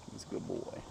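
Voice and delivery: soft whispered praise to the dog. Real, not acted. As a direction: whisper it gently to the pet. Praising pet softly.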